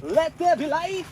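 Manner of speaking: slow, high, booming pronouncement; grand proclamation. Pretend. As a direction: declare it like a prophet. Opening tent windows, mock proclamation.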